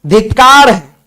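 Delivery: loud imitated self-curse; emphatic mock self-condemnation; self-reproach. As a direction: curse yourself with force, as if quoting. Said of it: "भगवान नाम अपने आप को कोसते".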